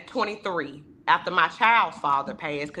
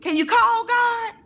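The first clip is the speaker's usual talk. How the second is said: much higher, louder grandma's plea; grandmother asking; 4 dB louder than usual. Retold or imitated. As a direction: ask it high like an old grandma. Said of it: "she said".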